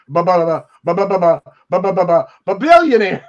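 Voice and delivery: stammering mockery, spitting out billionaires at last; stuttering impression of a man who can't say the word. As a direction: fake stumble on ba ba ba, then a loud billionaires. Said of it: "You can barely say the word".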